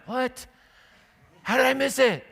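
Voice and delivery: high, bewildered disbelief; can't believe you missed it; dismayed surprise. Retold or imitated. Driver who missed the sheep, high and dismayed. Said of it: "you're just sitting there like".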